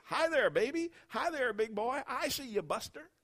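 put-on old bum greeting baby. Old bum calling across a diner to a baby, loud high coo. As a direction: call out to the baby, cooing.

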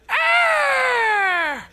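high, loud, strained screech; a harsh night-bird shriek, way up high; owl screech. Retold or imitated. Pastor imitating the noisy owl for his congregation, loud high screech. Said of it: "how he's breathing up there".

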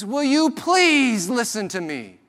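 high, loud pleading cry; desperate man. Retold or imitated. Vulgar man at restaurant pleading to be heard, desperate. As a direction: beg to be heard, straining. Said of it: "this is what every third or fourth word means".

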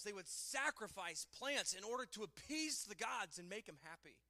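ordinary delivery

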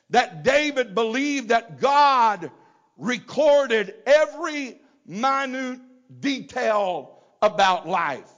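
high, emphatic pulpit cadence; forceful preaching. Acted. Preacher tells congregation God remembers every tear, forceful preaching. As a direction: drive each phrase home with pauses.

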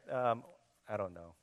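usual speaking voice

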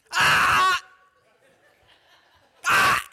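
very high, loud alarm yell; panicked shout. Retold or imitated. Father yelling at kids as the ball rolls to the road, loud alarmed shout. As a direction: blurt a sharp alarmed "Ah!" at the child. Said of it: "now they mock me".

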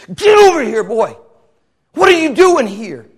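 put-on high, loud yell at a kid brother; hissed-then-yelled call. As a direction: bark him over, annoyed. Brothers yelling at David.

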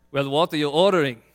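put-on pushy Austrian-accented trainer. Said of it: "in my best kind of Austrian accent".

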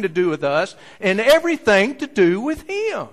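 loud, rising sermon climax; full-force preaching. Acted. Preacher proclaiming to the congregation, loud and rising. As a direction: preach it out to the back row.

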